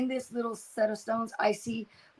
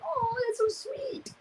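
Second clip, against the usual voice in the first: touched sweet reply; 13 semitones higher, 5 dB quieter, slower than usual. Retold or imitated. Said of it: "And I was like".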